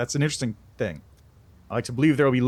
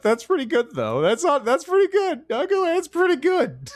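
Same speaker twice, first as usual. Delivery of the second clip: amused laughing. Real, not acted. High amused approval.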